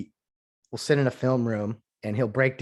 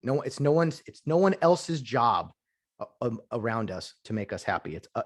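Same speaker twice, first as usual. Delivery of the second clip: ordinary delivery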